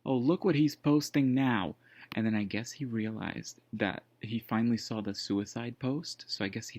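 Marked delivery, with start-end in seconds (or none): 0.0-1.8 indignant retelling. Retold or imitated. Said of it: "And he said".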